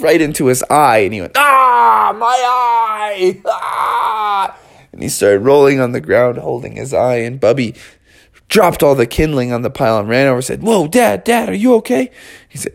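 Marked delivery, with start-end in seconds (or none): 1.4-4.6 high, hammed-up howl of pain; pained yell. Father struck in the eye by wood, pained howl. 10.6-12.1 put-on high, urgent worried kid; alarmed concern. Son rushes to injured dad, high-pitched alarm.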